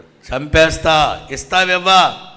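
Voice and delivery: loud, slow menacing demand; retelling a menacing demand for the mic, loud and slow; mock threat. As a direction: lean in and threaten. Threatening with the axe.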